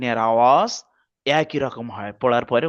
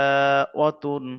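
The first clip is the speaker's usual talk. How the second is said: usual speaking voice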